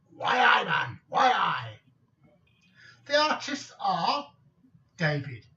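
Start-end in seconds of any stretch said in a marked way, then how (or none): 0.1-1.8 loud mock Geordie cry; northeast England dialect. Quoting how northeast England people talk, loud mock Geordie. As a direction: shout it like a northeast England local. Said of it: "where the people say".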